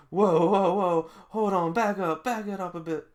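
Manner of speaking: high, loud whoa, hold on; alarmed hold on. Acted. Character stopping a teammate who got mad, loud and high. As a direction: pull everyone back, startled. Voicing character telling others stop.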